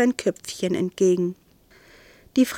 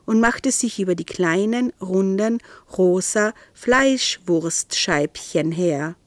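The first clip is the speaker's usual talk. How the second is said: ordinary delivery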